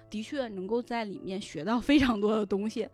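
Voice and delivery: normal talking voice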